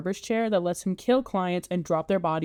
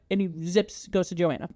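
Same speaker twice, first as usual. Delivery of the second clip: ordinary talk, nothing marked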